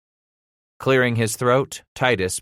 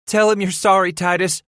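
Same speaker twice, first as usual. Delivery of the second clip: high, anxious mother's plea; begging; 11 semitones higher, 5 dB louder than usual. A narrator's reading. Mother to son before angry father, begging. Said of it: "his mother begged".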